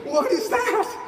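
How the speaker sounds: high, loud, laughing at himself; shocked at what came out of your mouth; laughing disbelief. Retold or imitated. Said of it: "You're like".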